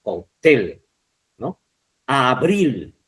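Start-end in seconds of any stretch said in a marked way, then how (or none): 2.1-2.9 "Abril" with the weight on "bril"; teacher's demo of "Abril", accent on "bril"; stress on last syllable. Shown as a demonstration. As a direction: lean on the final syllable of "Abril". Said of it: "Acento en bril".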